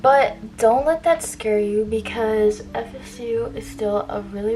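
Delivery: normal talking voice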